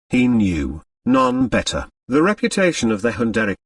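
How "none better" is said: plain reading voice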